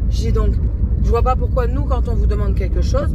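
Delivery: angry retelling. Retold or imitated. Retelling her angry call to the bank, heated and loud. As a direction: vent it like the call still stings. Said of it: "Je me suis énervée".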